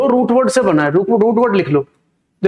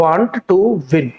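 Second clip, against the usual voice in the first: the right form, very slowly, every word laid out; slow correct form; 4 semitones lower, much slower than usual. Shown as a demonstration. Teacher slowly dictating the correct phrase to students. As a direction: spell out the correct phrase want to win slowly. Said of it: "want to के बाद वी टू नहीं आता".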